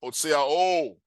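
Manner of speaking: "hotseao" with a long, held final o; lengthened final vowel. Shown as a demonstration. Teacher repeating the emphatic form, "hotseao" ending on a drawn-out o. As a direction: draw out the last vowel of "hotseao". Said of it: "you make a, a longer vowel".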